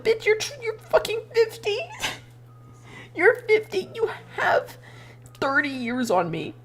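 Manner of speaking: high-pitched, exasperated retort; mock outraged comeback. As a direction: squeal out the disbelief at their age.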